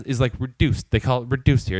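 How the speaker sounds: ordinary talk, nothing marked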